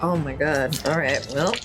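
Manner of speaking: normal talking voice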